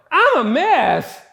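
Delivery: loud desperate admission; blurted confession. Retold or imitated. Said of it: "I tell him".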